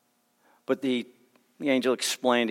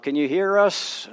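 raised, higher call through the rubble; rescuer shouting; 8 semitones higher, 5 dB louder than usual. Retold or imitated. Rescuer shouting to the trapped person through rubble, raised call. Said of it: "somebody shouts out".

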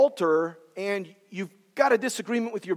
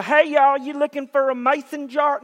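put-on Southern accent; 6 semitones higher, 5 dB louder than usual. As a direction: call out a hammed-up Southern welcome. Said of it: "how'd you lose your Southern accent".

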